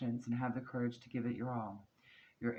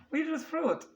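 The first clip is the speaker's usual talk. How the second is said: "We just fruit." squeaked high and loud in a mock Greek accent; hammed-up Greek dad quoting his toast; a high, loud bad Greek accent; 6 dB louder than usual. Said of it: "My terrible Greek accent there".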